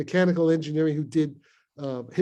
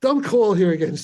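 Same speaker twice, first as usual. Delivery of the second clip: louder, higher stern order; angry order; 6 semitones higher, 7 dB louder than usual. Retold or imitated. Mother warning the caller off, loud and stern. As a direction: snap it down the phone before hanging up. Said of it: "so my mother said".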